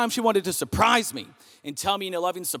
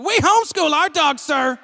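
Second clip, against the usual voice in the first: hammed-up Southern accent, pitched way up; exaggerated Southern accent; 9 semitones higher, 4 dB louder than usual. Talking back to the snooty neighbor in a thick Southern accent. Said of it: "In the most southern accent".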